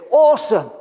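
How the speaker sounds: preacher retelling a comedian's cheerful Australian; mock Aussie cheer. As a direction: play the cheery Australian, "awesome" bright and high. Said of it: "The Australian will say".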